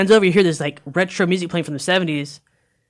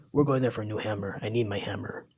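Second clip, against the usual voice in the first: a hint of low, quiet resolve; lightly voiced hero; 6 semitones lower, 8 dB quieter than usual. Hero telling the crew his quest, lightly voiced low. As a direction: lower and softer, lightly in character.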